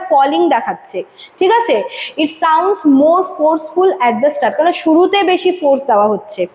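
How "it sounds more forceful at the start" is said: slow, deliberate textbook read-out; slow reading aloud. Acted. Teacher reading the definition to students, slow and deliberate. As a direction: read the definition slowly for the class. Reads textbook line slowly.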